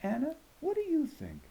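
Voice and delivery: high, inviting teacher question; encouraging teacher. A narrator's reading. Teacher invites Anna's opinion, high and inviting. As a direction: coax a shy student to answer. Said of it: "he would say".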